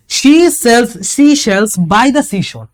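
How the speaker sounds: "She sells" said very slowly, every s and sh sound leaned on; stressing the s sounds. Shown as a demonstration. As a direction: drag out the hissing s and sh sounds. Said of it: "এই দেখেন, এই স". ("She sells" খুব ধীরে, প্রতিটা স আর শ ধ্বনি জোর দিয়ে)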